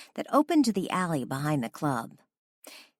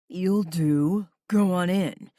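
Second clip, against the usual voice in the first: weird deep bouncer voice; 3 semitones higher, 4 dB louder, slower than usual. Retold or imitated. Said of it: "adopted a weird, deep voice".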